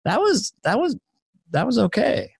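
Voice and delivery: high, halting reaction; mock pleasant surprise. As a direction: pause between each high-pitched try. Retold reaction on waking.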